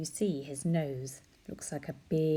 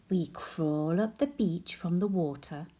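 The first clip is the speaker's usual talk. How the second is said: ordinary delivery, nothing marked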